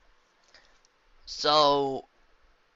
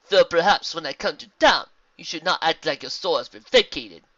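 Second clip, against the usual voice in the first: loud, higher zebra character voice; gracious zebra; 6 semitones higher, 10 dB louder than usual. Acted. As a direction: speak with formal, projected poise.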